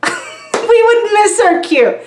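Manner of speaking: laughing. Real, not acted. Telling the audience about the concert, laughing. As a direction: giggle as you admit the flub.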